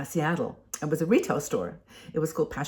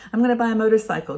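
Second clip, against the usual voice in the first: quick, louder burst of eager excitement; excited outburst; 5 semitones higher, 5 dB louder, faster than usual. Retold or imitated. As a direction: blurt it out like a sudden plan, fast and loud. Excited dream voiced aloud.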